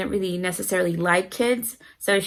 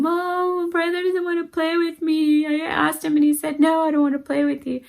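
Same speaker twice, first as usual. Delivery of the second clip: put-on crying child; 9 semitones higher than usual. Daughter tattling on her brother to mom, high mock crying. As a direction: imitate a little girl wailing her complaint. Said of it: "she comes crying".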